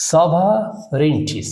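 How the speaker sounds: সভারেনিটি slowly split as "sova, renti"; syllable by syllable. Shown as a demonstration. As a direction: draw out সভারেনিটি in separated chunks. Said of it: "শুধু syllable করলে হবে না". (সভারেনিটি ধীরে, ভাগ করে বলা)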